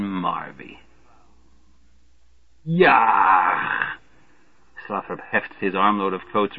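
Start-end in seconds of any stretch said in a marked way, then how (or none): 2.6-3.9 sudden cry of alarm; startled yelp. A narrator's reading. Slothrop yelping at the sudden sight of Marvey.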